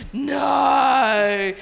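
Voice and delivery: a hammed-up cry of "No."; mock anguished no. Comic telling her stunned answer to the gala call, mock wail.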